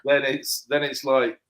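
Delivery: ordinary delivery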